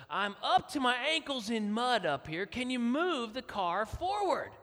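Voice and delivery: exasperated wife, slow on the last words; mud-soaked wife demanding, irritated and loud; not so sweet. Retold or imitated. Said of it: "it was not quite so sweet".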